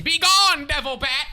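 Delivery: high, loud battle scream. Acted. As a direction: hurl it out at the dark. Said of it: "and scream".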